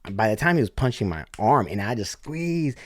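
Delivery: ordinary delivery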